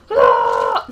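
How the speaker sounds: sudden loud yelp of fright; startled shout. Real, not acted. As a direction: yelp as if jumped at. Startled in game.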